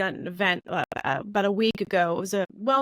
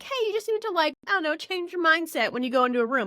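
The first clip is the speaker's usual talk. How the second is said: mocking vague advice; 6 semitones higher than usual. Mocking generic advice givers.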